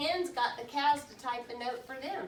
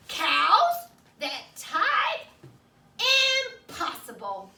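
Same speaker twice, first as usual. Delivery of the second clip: high, loud, slow stunned question; astonished disbelief; 4 semitones higher, 8 dB louder, slower than usual. A narrator's reading. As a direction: cannot believe it. Farmer stunned reading aloud.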